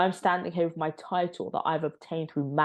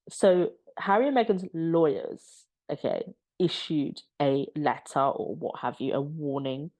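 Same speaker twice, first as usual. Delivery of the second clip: ordinary talk, nothing marked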